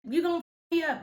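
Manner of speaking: high, fast put-on voice of a friend; imitating Kathleen. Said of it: "And she said something like".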